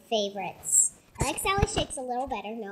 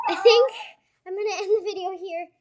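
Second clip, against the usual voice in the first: laughing; 5 semitones higher, 7 dB louder than usual. Real, not acted. Nervous laughing wrap-up.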